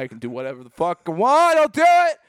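put-on building to a loud, high drunken yell; imitating a defiant drunk, rising into a shout. Drunk man defiantly yells back.

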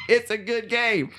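high-pitched, half-laughing defence; defensive protest. Real, not acted. Host defending his game pick to co-host, defensive protest. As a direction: protest up high against the teasing.

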